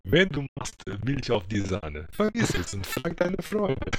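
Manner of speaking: put-on slow, high-pitched Russian accent in German. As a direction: drag the words out like a Russian speaking German. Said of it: "Kannst du den auch noch mal in russischem Akzent vortragen?".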